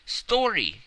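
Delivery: loud, high demanding cry; cried out. A narrator's reading. Editor demanding the traveler's story, loud cry. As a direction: shout for the story. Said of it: "cried the editor".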